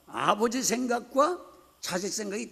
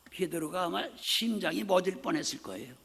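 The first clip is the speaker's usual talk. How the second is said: ordinary talk, nothing marked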